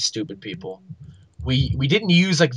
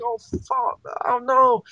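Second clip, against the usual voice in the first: high-pitched retold dismay; freaking out; 12 semitones higher, slower than usual. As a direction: moan it high in dawning panic. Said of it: "I'm, like, freaking out".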